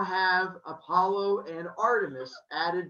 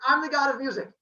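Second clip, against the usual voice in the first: higher-pitched, cocky proclamation; eager boast; 8 semitones higher, 4 dB louder, faster than usual. Acted. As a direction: jump in and announce it like a show-off. Retells Apollo boasting to impress.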